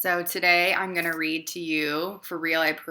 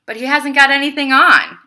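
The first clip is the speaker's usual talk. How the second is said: a lightly voiced child calling out, higher and louder; child's voice; 9 semitones higher, 14 dB louder than usual. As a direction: call it out like a bold little kid. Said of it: "a child's voice rose from the crowd".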